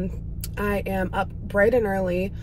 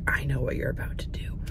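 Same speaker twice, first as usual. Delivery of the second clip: lower, whispered voice of the dog; 6 semitones lower, 7 dB quieter than usual. Retold or imitated. Owner voicing her dog who knows what's coming. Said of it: "He's like".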